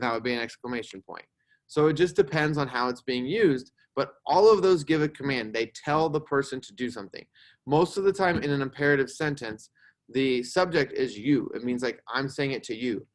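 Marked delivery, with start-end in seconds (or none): none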